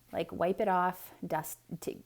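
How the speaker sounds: plain reading voice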